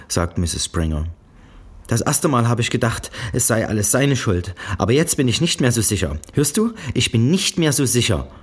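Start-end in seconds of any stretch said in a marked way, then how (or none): 1.9-8.3 Strafpredigt der Mutter. A narrator's reading.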